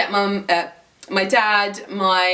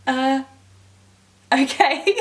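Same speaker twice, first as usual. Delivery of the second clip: higher, hesitant mock-polite reply; doubtful okay; 5 semitones higher, slower than usual. Passenger answering the northern guy's odd offer, hesitant and doubtful. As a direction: act out a puzzled, reluctant okay.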